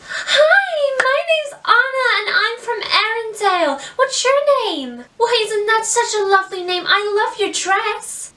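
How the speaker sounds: put-on bubbly high American accent, Anna voice; Anna's American accent. To a child at a party, high bubbly American accent. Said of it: "do my introduction as Anna".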